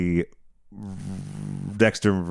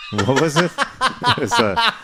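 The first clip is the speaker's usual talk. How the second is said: laughing; 9 semitones higher, slower than usual. Real, not acted. Laughing at a silly name.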